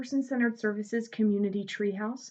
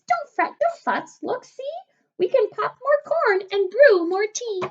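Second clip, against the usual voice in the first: tiny mouse voice, much higher, soothing the bear; squeaky mouse; 11 semitones higher, 6 dB louder than usual. Acted. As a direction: squeak out comfort to a sad bear. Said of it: "Mouse squeaks".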